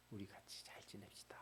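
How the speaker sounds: whispered gentle promise to a wife; tender whisper. Acted. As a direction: breathe the words softly, a husband making peace. Hosea's whispered promise to Gomer.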